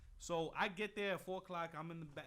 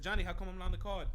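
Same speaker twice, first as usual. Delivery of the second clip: tags 1; 3 semitones higher, 9 dB louder, faster than usual. Retold or imitated. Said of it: "I said".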